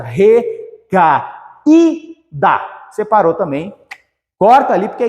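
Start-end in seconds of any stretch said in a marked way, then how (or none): 0.0-1.3 "Recaída" slowly split as re-ca-í-da; syllable separation. Shown as a demonstration. Teacher dividing the word like saúde, slow separated syllables. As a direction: give each syllable of "Recaída" its own beat. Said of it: "mesma coisa".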